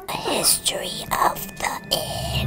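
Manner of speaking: muffled whisper into shirt; talking into sleeve. Real, not acted. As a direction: speak softly into your collar. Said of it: "talk into my shirt".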